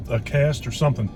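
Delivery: normal talking voice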